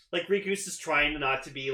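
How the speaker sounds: ordinary delivery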